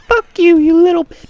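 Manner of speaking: loud, extremely high-pitched squeal; mock him in a piercing falsetto shriek; strained high voice. Shown as a demonstration. Showing how not to chirp people, loud squeaky mock voice. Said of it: "hurting my voice box just doing that".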